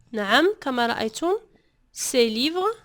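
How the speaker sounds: normal talking voice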